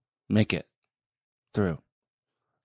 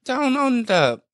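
much higher squeaky hammed-up Aussie; mock Australian; 11 semitones higher, slower than usual. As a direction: sound like a little boy faking Aussie. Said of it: "You sound like a little boy".

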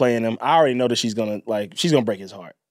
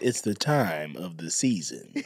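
slow, low radio DJ voice; smooth late-night radio host; 8 semitones lower, 9 dB quieter, slower than usual. Pretend. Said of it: "that's my radio voice".

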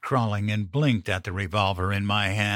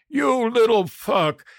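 furious roar, much higher and louder; roaring in pain; 12 semitones higher, 5 dB louder than usual. A narrator's reading. Moses roars at the kid after the foot wound. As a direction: bellow it out in rage and pain. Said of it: "He roared".

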